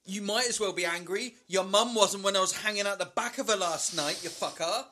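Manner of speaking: high-pitched gloating chav; chav trash talk. Acted. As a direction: needle the bear like a chav. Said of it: "trash talking a brown bear".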